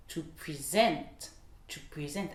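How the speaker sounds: SENT stressed in "present", first syllable light; verb stress. Shown as a demonstration. Teacher gives the verb form, stress on SENT in "present". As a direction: lean on the end: to pre-SENT. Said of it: "présenter quelqu'un".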